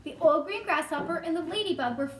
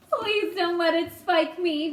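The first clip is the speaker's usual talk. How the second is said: slow, louder put-on pleading; begging; 3 semitones higher, 5 dB louder, slower than usual. A narrator's reading. Earthworm fearing the seagull's beak, begging. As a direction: plead pitifully in a character voice. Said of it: "begged the Earthworm".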